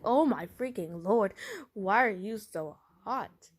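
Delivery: loud, drawn-out disbelief; hammed-up alarmed outburst; acted exasperated shock. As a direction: exclaim it slowly, playing up the shock. Girlfriend shocked at his fever.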